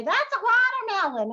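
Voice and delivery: put-on a child sure it's a watermelon; imitating a kid. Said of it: "They would just say".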